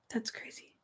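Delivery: breathy awed whisper, nearly silent; murmuring in disbelief. Real, not acted. Streamer whispering amazement at a left-handed viewer.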